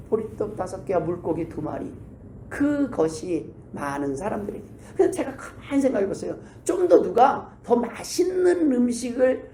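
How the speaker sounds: ordinary talk, nothing marked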